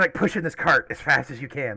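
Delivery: normal talking voice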